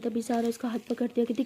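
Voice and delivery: plain reading voice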